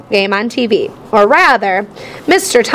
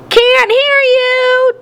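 high, slow shout back; shouted reply; 11 semitones higher, much slower than usual. A narrator's reading. Dad shouts back to Mom over the TV. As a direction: bellow over the noise. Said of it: "Dad responded".

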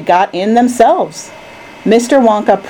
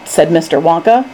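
plain reading voice

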